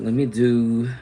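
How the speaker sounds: ordinary delivery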